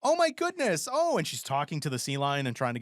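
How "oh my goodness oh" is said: high, mock-startled woman's voice; put-on high lady exclamation; imitated lady. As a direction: exclaim it as a flustered lady, pitched way up. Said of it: "she's like".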